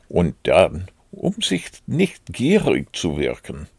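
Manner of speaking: usual speaking voice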